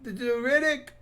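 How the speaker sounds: high-pitched, failed Scottish accent; attempted Scottish accent. Shown as a demonstration. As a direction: have a clumsy go at a Scottish accent. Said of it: "I can't do it".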